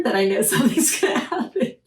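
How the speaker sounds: laughing nervously through the words; a jittery giggle breaking in; nervous laughter. Real, not acted. Describing reading a scary book, nervous laughing. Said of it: "that nervous laughter".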